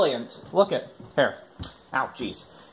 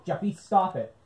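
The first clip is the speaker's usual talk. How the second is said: ordinary talk, nothing marked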